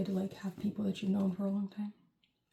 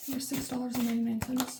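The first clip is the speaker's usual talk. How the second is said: higher, bright-eyed put-on voice with a rising end; 4 semitones higher than usual. Vlogger repeating the glasses price, playful put-on voice. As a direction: say it again as a cheerful bit.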